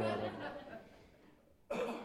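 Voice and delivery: slow, soft words through real laughter; laughing. Real, not acted. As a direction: chuckle it out, tickled. Pleased by the congregation's amen.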